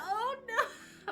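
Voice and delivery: high laughing oh no; giggling cry of dismay. Real, not acted. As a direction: laugh through the horror. Reacts to sim death laughing.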